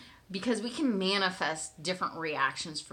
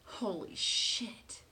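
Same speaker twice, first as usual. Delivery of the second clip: breathless, whispered holy shit; stunned whisper; 6 dB quieter, much slower than usual. Retold or imitated. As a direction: whisper it in disbelief. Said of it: "and I'm like".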